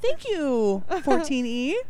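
high passenger voice. Acted. Acting a seat passenger.

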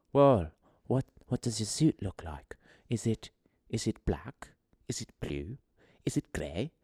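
soft, whispery British lady impersonation; halting mock British granny, half whispered; old British woman's accent. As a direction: ask in a hushed, put-on old British woman's voice. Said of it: "an old British woman's accent".

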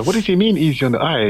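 high, puzzled asking; baffled, ask what it means; confused question. Retold or imitated. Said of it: "So I had to ask the producer".

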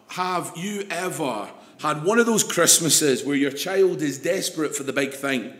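ordinary delivery

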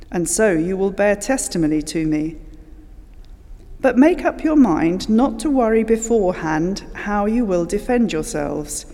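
usual speaking voice

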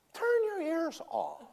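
very high, slow, strained plea to his wife; hammed-up husband at the end of his rope; mock exasperation. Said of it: "And I'm going".

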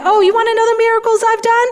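high, fast, mock-boastful brag. God mockingly imagined bragging, high and fast. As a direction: rattle it off like a show-off. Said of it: "God didn't try to defend himself".

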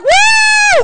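very high, loud whoop; joyful whoop. Retold or imitated. African church members whooping as they give. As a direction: whoop like dancing down the aisle. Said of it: "They're like".

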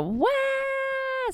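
high piercing imitated baby shriek; mock baby scream. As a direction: squeal it like a baby testing her lungs. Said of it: "skrek hon så här".

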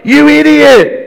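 loud, high-pitched mock insult; [name]. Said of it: "now he's name calling".

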